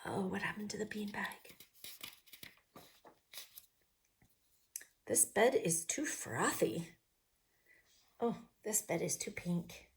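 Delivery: unvoiced whisper. Real, not acted. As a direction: hush it softly. Whispered asides.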